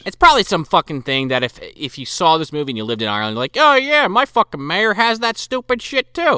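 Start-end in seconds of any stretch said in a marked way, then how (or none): 3.5-6.4 imagined Irish viewer. Retold or imitated. Imagined Irish viewer recognising the costume.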